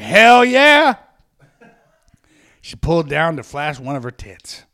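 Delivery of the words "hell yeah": loud, high whooping cheer; the dwarfs whooping at the show, a loud high cheer. A narrator's reading. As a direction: cheer it out like a rowdy crowd. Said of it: "who were cheering".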